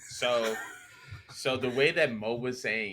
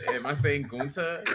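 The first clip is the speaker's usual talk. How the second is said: normal talking voice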